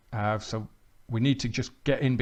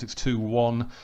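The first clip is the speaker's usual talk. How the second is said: ordinary talk, nothing marked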